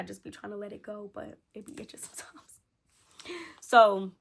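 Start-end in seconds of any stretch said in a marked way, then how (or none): 0.0-2.6 soft breathy whisper, half laughing; whispered aside. Real, not acted. Whispering to viewers about her itchy scalp. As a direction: drop to a whisper about the itch.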